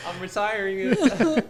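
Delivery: high, loud, through laughter; laughing. Real, not acted. Giving up the failed accent, laughing. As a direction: crack up while saying it.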